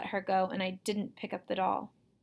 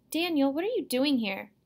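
higher-pitched, taken aback; mildly indignant surprise; startled question; 5 semitones higher, 4 dB louder than usual. A narrator's reading. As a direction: ask as if caught off guard. Sister startled by brother's arrival.

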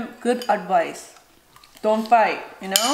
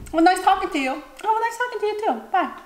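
higher, fast polite goodbye; mock polite farewell; 9 semitones higher, faster than usual. Ending small talk politely, higher fast mock voice. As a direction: wrap it up sweetly. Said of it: "polite goodbye".

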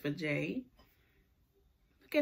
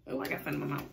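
normal talking voice

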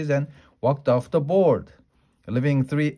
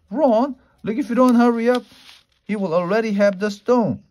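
high, raised urgent plea; urgent urging; 9 semitones higher, 5 dB louder than usual. A narrator's reading. Ron warning that the stone will be gone, urgent. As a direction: press them hard, pitched up and louder. Ron urges his friends to hurry.

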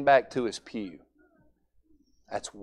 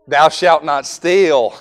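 recited commandment; 4 semitones higher, 11 dB louder, slower than usual. Retold or imitated.

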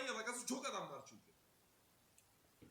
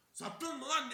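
louder, higher accusing bark; angry bark; 8 semitones higher, 7 dB louder, slower than usual. Retold or imitated. Sergeant repeats treason accusation, angry bark. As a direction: snap it at the suspect.

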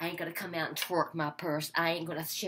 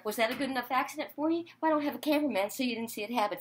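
usual speaking voice

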